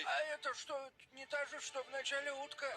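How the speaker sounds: shocked. Retold or imitated. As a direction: blurt it out in disbelief. Retells own confused question.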